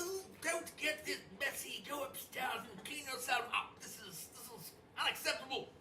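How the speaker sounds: high, fussy French chef voice. Acted. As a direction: scold like a haughty French chef. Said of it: "Archibald is, is, uh, says".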